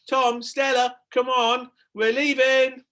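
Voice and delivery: high, loud call across garden; mother calling. A narrator's reading. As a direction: call the kids from afar.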